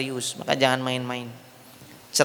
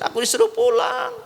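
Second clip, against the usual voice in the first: high, hammed-up tearful whine; mock crying; 7 semitones higher than usual. Wife telling mother she was sent away, mock crying. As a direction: whine it out in fake sobs, pitched high. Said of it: "Dia pulang nangis".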